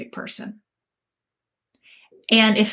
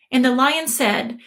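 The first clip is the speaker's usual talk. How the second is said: plain reading voice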